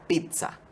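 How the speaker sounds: slow, pizza said pitsa with a T and a Z; correct Italian pizza. Shown as a demonstration. Host demonstrates the right way: slow pizza as pitsa. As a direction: model pizza as pitsa, slowly. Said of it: "con una T y una Z".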